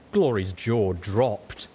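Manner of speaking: ordinary delivery, nothing marked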